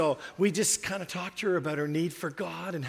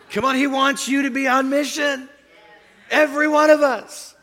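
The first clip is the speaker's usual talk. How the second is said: much higher and louder exhortation; preaching shout; 8 semitones higher, 9 dB louder than usual. Acted. Pastor urging every listener to mission, shouting. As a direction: call the whole room up.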